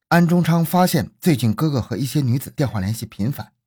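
ordinary delivery, nothing marked

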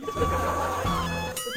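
very high squealing laugh. Real, not acted. Laughs at own joke.

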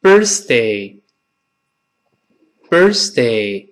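birthday drawn out very slowly, clear th and r; birthday in slow motion; slow model reading. Shown as a demonstration. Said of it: "完整的读一遍".

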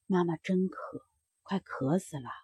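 a sick mother nearly out of strength, barely audible; weak and thirsty. Acted. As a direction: let the voice sink away as if too weak to go on. Mother too weak, begging.

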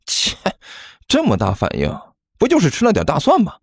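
scoff, then rising teasing question; smiling dismissiveness. Acted. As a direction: shrug it off with a smile. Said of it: "甘球笑眯眯地说道". (笑眯眯地不以为然)

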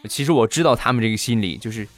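ordinary talk

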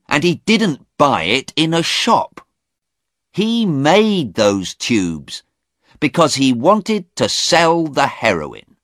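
high-pitched, insistent anger; angry. A narrator's reading. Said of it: "Stephen said angrily".

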